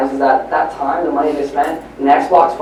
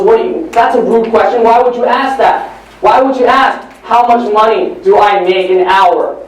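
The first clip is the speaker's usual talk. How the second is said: irritated father. Acted. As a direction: scold an interrupting child. Father snaps at interrupting son.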